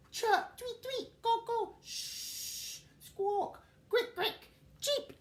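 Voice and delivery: high, squeaky little bird calls; chirpy bird voices. Acted. Teacher voicing waking birds as high chirps.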